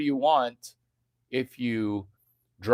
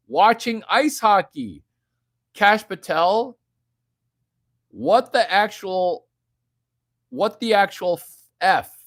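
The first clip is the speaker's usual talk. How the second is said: excited louder outburst; 5 semitones higher, 5 dB louder than usual. Real, not acted. Excited rant at news.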